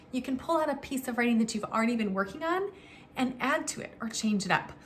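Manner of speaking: ordinary talk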